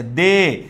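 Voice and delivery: দে held long on its এ; stretched vowel. Shown as a demonstration. Teacher isolating the third vowel for students, দে held long. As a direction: draw out দে so the এ stands out. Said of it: "দয়ের সাথে এ উচ্চারিত হচ্ছে".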